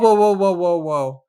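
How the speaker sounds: loud, high run of whoas; alarmed interjection. Real, not acted. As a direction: halt the reading in sudden alarm. Reader interrupts at a suspicious line.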